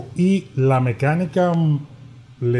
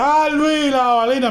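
mock player voice; 10 semitones higher, slower than usual. Player mimicked calling the catchphrase.

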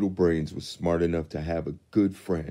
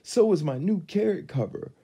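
Rabbit voice; 11 semitones higher, 5 dB louder than usual. A narrator's reading. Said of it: "Rabbit chimed in".

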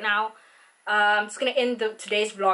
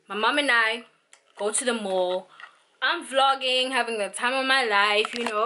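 ordinary talk, nothing marked

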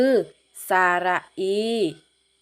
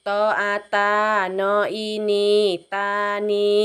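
spelling drill; 5 dB louder than usual. Shown as a demonstration. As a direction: sound out each syllable evenly for children to follow. Leads a slow spelling drill. (อ่านสะกดคำช้าๆ ลากเสียงทีละพยางค์)

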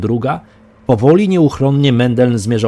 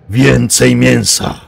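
slow, loud monster voice; taunting monster; 3 semitones higher, 6 dB louder, much slower than usual. A narrator's reading. Monster mocks its hidden prey, taunting. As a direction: gloat like a hungry beast. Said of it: "zadrwił z nich potwór".

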